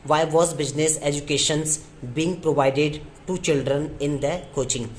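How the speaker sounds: slow, word-by-word dictation. Shown as a demonstration. Teacher dictating the sentence to students, slowly. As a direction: spell it out word by word for the class. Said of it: "dictating the sentence".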